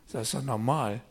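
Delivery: normal talking voice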